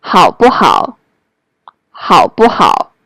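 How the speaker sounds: short light "不" between two "好"; neutral tone in the middle. Shown as a demonstration. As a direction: keep "不" brief and unstressed between both "好". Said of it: "it will become the neutral tone".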